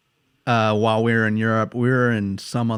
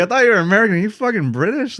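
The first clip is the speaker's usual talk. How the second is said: much higher, louder, disbelieving; baffled stranger; 10 semitones higher, 5 dB louder, faster than usual. Retold or imitated. Drunk night in Spain, stranger baffled by the slang. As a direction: ask it like you can't believe your ears. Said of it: "He's like".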